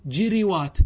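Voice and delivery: جروات as "jiriwaat", short i after ج and ر; careful "ji-ri-waat" for جروات; kasra on both. Shown as a demonstration. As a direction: say جروات with the ر copying the i of ج. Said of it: "بكسر الفاء والعين".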